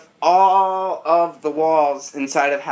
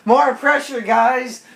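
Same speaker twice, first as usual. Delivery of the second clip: higher, urgent call for help; 6 semitones higher than usual. Acted. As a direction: call out to the others. Calling out urgently.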